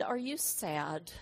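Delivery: gentle question. Retold or imitated. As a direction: ask a hurting child softly. Gently asking a sad child.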